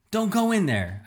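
high, loud frantic yell; a yell from across the room; yelled warning. Acted. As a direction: shout to stop him going in. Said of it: "Iris yelled".

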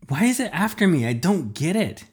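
high, fast frantic question; panicked. A narrator's reading. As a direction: blurt it out in panic. Panicked question while running.

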